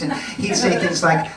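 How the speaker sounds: ordinary talk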